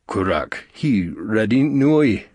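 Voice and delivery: gruff voice over an intercom; gruff alien voice. Acted. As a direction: bark it like a gruff guard. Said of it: "A gruff voice coming over the intercom".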